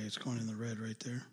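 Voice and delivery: hushed aside off-mic; whispering. Real, not acted. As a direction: whisper so the stream won't hear. Quiet mic-level aside on air.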